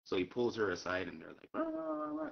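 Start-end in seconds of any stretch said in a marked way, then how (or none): 1.5-2.3 high-pitched mumbled blah-blah; wordless babble standing in for talk; imitated chatter. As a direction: mimic two people talking out of earshot. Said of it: "like, talking".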